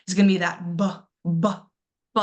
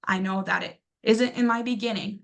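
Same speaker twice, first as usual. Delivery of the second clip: ordinary delivery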